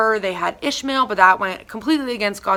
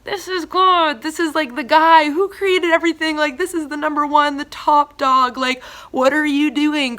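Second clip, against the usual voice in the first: higher, louder incredulous outburst; raised-pitch cry of disbelief; exasperated disbelief; 6 semitones higher, 4 dB louder than usual. Retold or imitated. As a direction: plead with Abraham in disbelief. Exasperated rebuke of Abraham.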